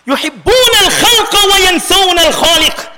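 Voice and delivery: forceful quotation. Retold or imitated. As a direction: proclaim it to the congregation. Same saying repeated with force.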